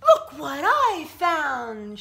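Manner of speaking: slow, loud and high; triumphant shout. Acted. Jessica showing her find to the frogs, triumphant shout. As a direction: crow it out in triumph. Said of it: "she shouted triumphantly".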